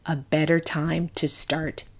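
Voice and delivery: measured, deliberate sponsor read with pauses; podcast ad read. Acted. Host reads dating app ad.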